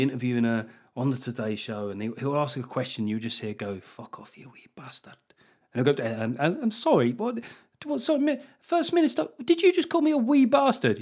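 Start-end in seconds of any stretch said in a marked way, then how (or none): 3.8-5.2 hissed under the breath, unvoiced; mock whispered snarl. Sturgeon snapping at interviewer. 6.0-11.0 much higher, flustered, disbelieving; a startled posh presenter squeaking; shocked interviewer. Pretend. Interviewer stunned, asking.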